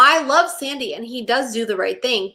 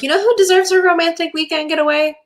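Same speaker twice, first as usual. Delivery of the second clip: mock announcement; 7 semitones higher, 3 dB louder than usual. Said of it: "is like".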